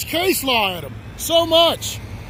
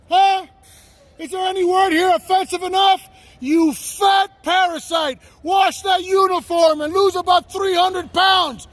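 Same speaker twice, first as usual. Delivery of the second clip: angry yelling. Real, not acted. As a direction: hurl abuse across at them. Yelling insults at police.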